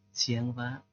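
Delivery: very soft, humble greeting; hushed respectful hello; deferential greeting. Acted. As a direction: greet the boss meekly, well under your voice.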